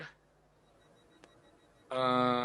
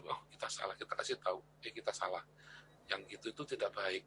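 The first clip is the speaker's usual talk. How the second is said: soft admission; 6 semitones lower, 14 dB quieter than usual. Retold or imitated. Voicing admission to children.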